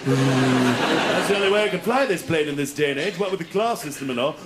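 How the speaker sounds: put-on upper-middle-class English accent over the radio; hammed-up upper-middle-class officer accent; upper-middle-class pilot. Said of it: "This is your upper middle class pilot speaking".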